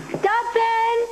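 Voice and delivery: high, long bellow across the house; bellowing for the butler. Acted. Emily bellows for the butler Dobson. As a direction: call the servant at full voice. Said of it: "we never bellow like that".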